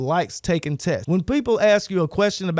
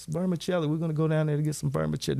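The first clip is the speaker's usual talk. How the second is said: soft, low repeat; mock low talker, barely audible to the listener; 3 semitones lower, 6 dB quieter, faster than usual. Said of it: "they talk so low".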